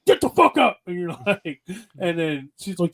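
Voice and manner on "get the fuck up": high, loud barked order; retold yell. Husband yelling at wife to go to bed, loud shout. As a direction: snap her up off the couch with a shout. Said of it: "I had to yell at you".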